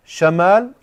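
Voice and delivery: "Shamal" with a short a after the sh; fatha on shin. Shown as a demonstration. Teacher shows the class the word for north, fatha on shin. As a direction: say "Shamal" with an open a in the first syllable. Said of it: "with the fatha".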